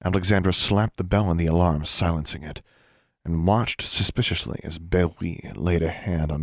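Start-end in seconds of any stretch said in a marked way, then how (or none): none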